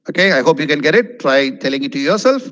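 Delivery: ordinary talk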